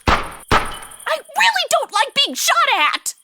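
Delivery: high, loud, drawn-out cry of alarm; panicked yell. Acted. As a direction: yelp it as the bullets fly. Scared of the gunfire.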